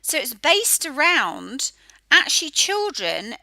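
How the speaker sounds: ordinary talk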